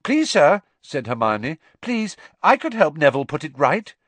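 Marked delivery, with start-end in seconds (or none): 0.0-0.6 put-on high, loud pleading girl; eager Hermione. Hermione begging Snape to let her help, high and urgent. 1.8-3.9 put-on high, loud pleading girl; eager Hermione. Hermione begging Snape to let her help, high and urgent.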